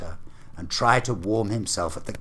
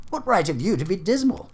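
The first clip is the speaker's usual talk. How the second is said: much higher, lively teasing retort; gaily; 13 semitones higher, 4 dB louder than usual. Acted. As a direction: toss it back, bright and teasing. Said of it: "returned the nephew gaily".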